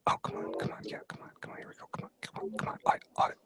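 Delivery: hushed, urgent whisper to himself. Retold or imitated. Imitating the man in the next stall, urgent whisper. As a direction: whisper it, egging yourself on. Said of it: "whispering to himself".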